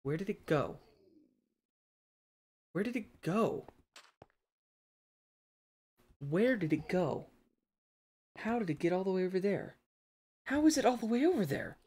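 high, loud, incredulous questions; baffled disbelief. Real, not acted. Pokemon vanished, frantic searching.

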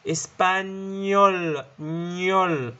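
"إسبانيول" very slow, ny stretched, then "نيول" alone; syllable-by-syllable demo. Shown as a demonstration. Teacher building the word espagnol for students, slow and stretched. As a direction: draw out the nyol ending of "إسبانيول". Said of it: "فبتصير إسباني".

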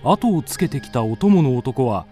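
ordinary talk

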